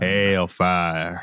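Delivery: long, low Southern drawl; Southern accent. Shown as a demonstration. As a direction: stretch hell fire as Southern as possible. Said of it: "as Southern as you can get".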